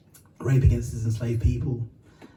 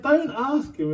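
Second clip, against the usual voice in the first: higher-pitched angry retort, retold; 11 semitones higher, slower than usual. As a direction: retell an exasperated protest, pitched up. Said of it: "it made me really angry".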